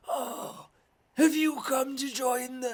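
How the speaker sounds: put-on high, strained old-wizard rasp; strained raspy old wizard. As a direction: squeeze the words out like a frail old mage. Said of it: "strained, raspy voice".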